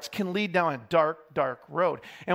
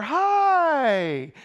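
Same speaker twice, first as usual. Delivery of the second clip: higher, bright put-on hello; fake cheerful greeting; 8 semitones higher, 4 dB louder than usual. Parent walks into church after scolding kids, higher mock-cheerful hi. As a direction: greet them as if nothing happened.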